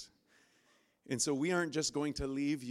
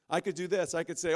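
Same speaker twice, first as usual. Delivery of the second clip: normal talking voice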